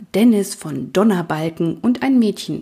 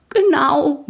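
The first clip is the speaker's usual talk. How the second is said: high, drawn-out grunted agreement; pony grunt; 9 semitones higher, 4 dB louder than usual. A narrator's reading. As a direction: grunt it out emphatically, pitched up. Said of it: "grunzte Schoko". (zustimmend grunzen)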